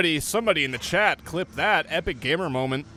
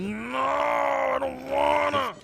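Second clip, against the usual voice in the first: whiny refusal; 12 semitones higher, much slower than usual. Pretend. Mock whining refusal.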